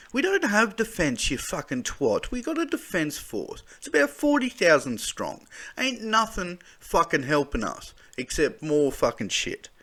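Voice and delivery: high, loud swearing rant; furious rant. Real, not acted. Mocking defence claims, he rants furiously. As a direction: tear into it with real anger. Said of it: "without getting just furious".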